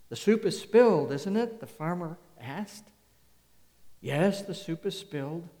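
loud, pointed questions from the pulpit; farmer's calm logic, belted out; loud preaching. Acted. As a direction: drive each question home.

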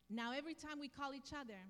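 ordinary talk

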